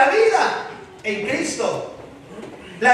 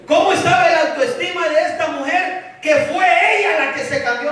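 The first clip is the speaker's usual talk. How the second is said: higher, building sermon refrain; preaching at full force; 4 semitones higher than usual. Acted. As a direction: hammer each "nadie dijo" home.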